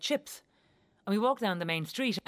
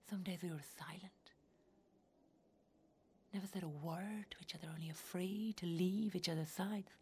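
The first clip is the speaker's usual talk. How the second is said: very soft, near-whispered recollection; barely voiced, far softer storytelling; hushed; 3 semitones lower, 13 dB quieter than usual. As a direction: drop right down to a hush. Tender memory of silent days.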